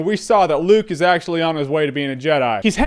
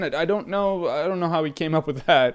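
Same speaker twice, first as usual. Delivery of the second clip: muttered aside; 4 semitones lower, 7 dB quieter than usual. Real, not acted. Mumbled aside, quiet far.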